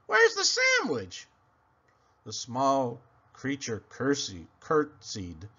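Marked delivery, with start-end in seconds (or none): 0.1-1.3 very high begging whine. Acted. Hungry pig begs very high. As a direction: plead for the food.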